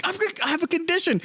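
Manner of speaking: high, defensive cartoon voice; Mister Crocker. Fan voicing cartoon teacher's excuse about neck ear, high defensive voice. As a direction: pitch it way up as a feeble excuse.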